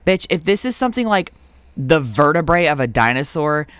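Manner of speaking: usual speaking voice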